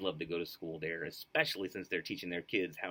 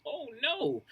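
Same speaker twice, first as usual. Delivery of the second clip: moaning; 3 dB louder than usual. Acted. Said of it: "we moaned".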